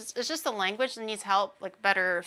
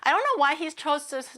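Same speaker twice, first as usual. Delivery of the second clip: normal talking voice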